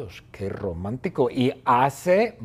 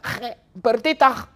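high exasperated shout; high-pitched holler back; yelled name; 10 semitones higher, 4 dB louder than usual. Acted. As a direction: cry the name out in protest. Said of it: "le grita".

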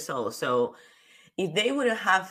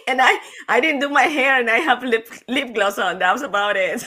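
laughing; 7 semitones higher, 6 dB louder than usual. Real, not acted. Laughing at the compliment.

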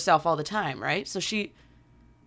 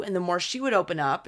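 ordinary talk, nothing marked

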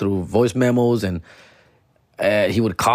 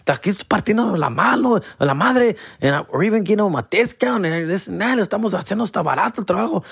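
high-pitched, rapid mock indignation; offended; 8 semitones higher, faster than usual. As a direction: rattle off a wounded complaint, climbing higher. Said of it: "they get, like, offended".

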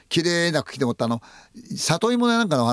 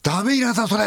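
shouted warning; 9 semitones higher than usual. Retold or imitated. Local organizer shouting a warning.

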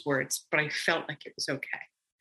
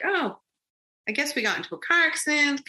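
casual surprise; 8 semitones higher than usual. Retold or imitated. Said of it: "I'm kinda like".

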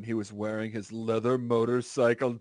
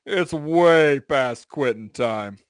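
slow, loud put-on Joe Swanson; slow, booming cartoon-cop voice; 3 semitones higher, 9 dB louder, much slower than usual. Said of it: "I see Joe Swanson in my mind".

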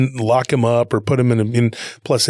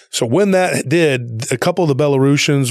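ordinary talk, nothing marked

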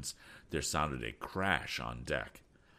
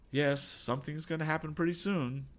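observing captain; 10 semitones higher than usual. A narrator's reading. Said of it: "observed Captain Weston".

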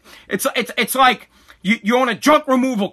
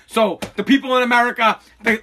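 ordinary talk, nothing marked

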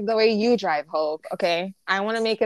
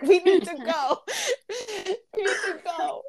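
higher-pitched plea broken up by laughter; laughing retelling; 8 semitones higher than usual. Retold or imitated. Hurrying a friend to the concert, laughing.

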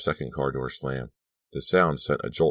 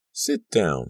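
ordinary delivery, nothing marked

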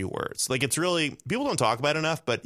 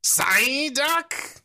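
high, long creature cry; piercing, drawn-out cartoon squawk; Psyduck impression; 4 dB louder than usual. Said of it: "I just gotta get back into character".

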